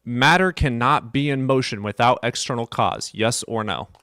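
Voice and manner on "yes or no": ordinary delivery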